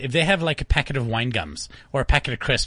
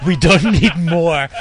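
louder, slow retort; 2 semitones higher, 8 dB louder, slower than usual. Retold or imitated. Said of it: "She said".

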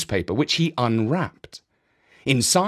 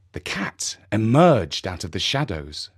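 usual speaking voice

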